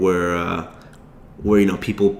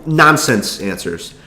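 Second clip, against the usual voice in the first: ordinary delivery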